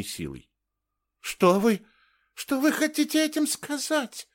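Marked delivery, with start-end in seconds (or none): 1.2-4.3 very high, thin woman's voice; falter, then ask in a high, small voice; faint, shaken question. A narrator's reading. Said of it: "еле слышно прошептала она". (слабый, растерянный высокий голос)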